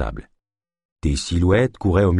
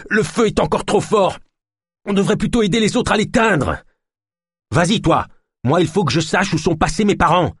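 high, urgent raised voices; agitated, insistent shouting match; heated argument; 10 semitones higher than usual. A narrator's reading. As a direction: argue fiercely over the roar of a fire. Heated quarrel beside the fire.

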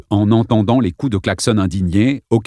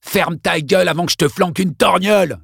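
shouting; 8 semitones higher than usual. Acted. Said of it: "cria Congdon".

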